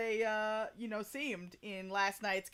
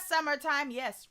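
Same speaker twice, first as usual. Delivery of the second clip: ordinary talk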